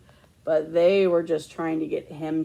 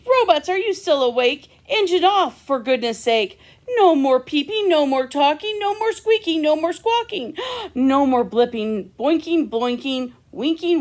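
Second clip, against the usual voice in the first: louder, higher scolding; exasperated parent scolding; stern parent; 7 semitones higher, 7 dB louder than usual. Acted. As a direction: tell the robots off at bedtime. Parent scolding robots to sleep.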